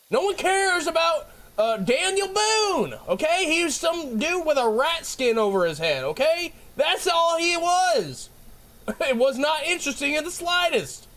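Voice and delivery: high, indignant comic yelling; worked-up, dismissive tirade; yelling rant. Real, not acted. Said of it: "I'm yelling at everything".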